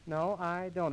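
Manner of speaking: ordinary talk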